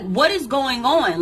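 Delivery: loud, high retold question; alarmed demand. Frightened patient confronts the nurse, loud and urgent. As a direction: demand answers from the staff.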